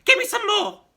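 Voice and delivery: put-on delighted old witch shrieking; crying out crone. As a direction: crow it out gleefully. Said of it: "she cried".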